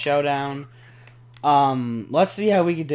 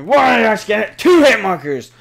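high, very loud indignant yelling; outraged shout; 7 semitones higher, 11 dB louder than usual. Real, not acted. Player protesting two hit markers, outraged shout. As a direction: shout it in disbelief at the game.

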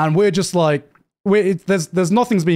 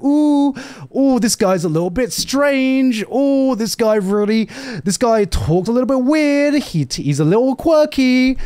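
mock fascination; 6 semitones higher than usual. Mocking anime boys who each need a quirk, sarcastic. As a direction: feign wonder in a pitched-up voice.

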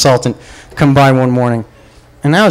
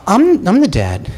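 normal talking voice

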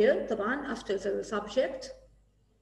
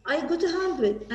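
higher, louder proud pupil; proud boast; 5 semitones higher, 4 dB louder than usual. Pretend. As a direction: announce the score proudly.

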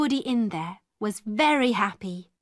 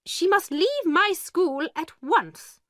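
much higher, hard-edged order; an angry headmistress laying down the law; 8 semitones higher than usual. A narrator's reading. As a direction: throw the girl out, furious. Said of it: "Miss Minchin said angrily".

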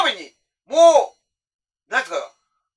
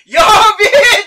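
very high, very loud yell of fright; frightened shout; 12 semitones higher, 12 dB louder, faster than usual. Retold or imitated. Driver spotting a figure in the rear-view mirror, yelling in fright. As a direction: yelp as the shadow shows in the mirror.

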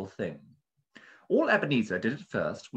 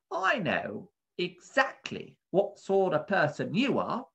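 slow, high suspicious shop keeper; drawn-out knowing threat; menacing bird keeper; 10 semitones higher than usual. Acted. Bird keeper's hard stare.

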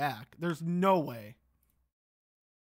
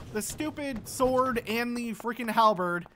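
ordinary talk, nothing marked

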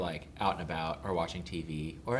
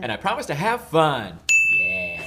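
excited shout; 10 semitones higher, 12 dB louder than usual. Real, not acted. Loud excited oath repeat.

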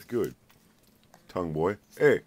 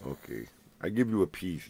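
ordinary talk, nothing marked

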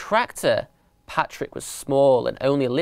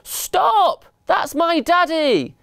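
very high, drawn-out desperate yell; big childish shout; much slower than usual. A narrator's reading. Young elephant to mice carrying off his dad, big childish shout. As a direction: yell it in your biggest little-kid voice. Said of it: "shouted in his biggest, strongest voice".

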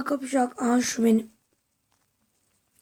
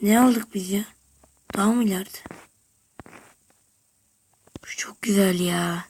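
hushed gamer whisper; 4 semitones lower, 4 dB quieter than usual. Real, not acted. Gamer sneaking through the map, whispering. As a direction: whisper it so nobody hears. Secret whispering while looting.

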